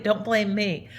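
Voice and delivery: ordinary talk, nothing marked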